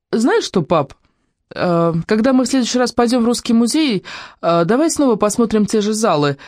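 put-on high girlish voice, hesitantly casual; feigned indifference. A narrator's reading. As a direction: sound offhand to dad, with little hesitations. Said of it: "стараясь говорить самым безразличным тоном".

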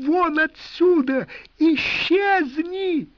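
high, loud drawn-out screams; hysterical screaming. A narrator's reading. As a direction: scream him out of the house. Wife screams him out.

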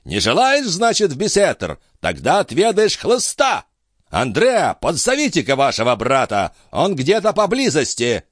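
put-on high-pitched threatening old baron; irate high-voiced commands; indignant cry. A narrator's reading. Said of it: "вскрикнул барон".

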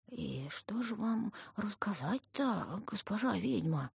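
put-on slow, drawn-out fawning old man; ingratiating. A narrator's reading. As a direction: wheedle humbly to please the witch. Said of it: "заискивающе спросил дедок". (заискивающе, по-стариковски протяжно)

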